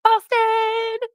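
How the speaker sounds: Boston! called out high as a hype greeting; greeting shout. Pretend. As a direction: belt the name out like a friend just walked in. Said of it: "we're gonna say".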